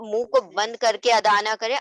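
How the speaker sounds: ordinary delivery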